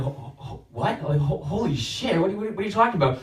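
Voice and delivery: stammering disbelief, breaking into a laugh; shocked stammer. Retold or imitated. Catholics reacting to God, shocked stammering. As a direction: sputter in confusion.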